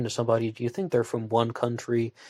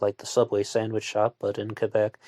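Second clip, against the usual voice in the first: ordinary talk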